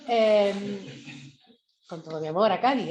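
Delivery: normal talking voice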